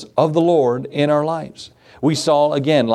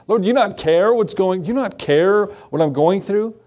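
higher, pleading complaint to the Lord; complaining to God; 6 semitones higher than usual. Retold or imitated. Believer pleading with God, feeling abandoned, higher and pleading. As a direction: plead like you feel forgotten.

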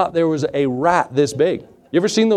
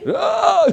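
much higher short shriek of fright; startled scream. Retold or imitated. Pastor retelling screaming when the rat jumped at him, high shriek. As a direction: yelp as the rat jumps. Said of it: "I screamed".

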